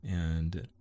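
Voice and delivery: normal talking voice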